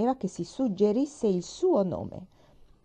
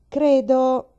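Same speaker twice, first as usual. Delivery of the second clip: loud start; 8 semitones higher, 11 dB louder than usual. A narrator's reading. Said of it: "cominciò zio Clon".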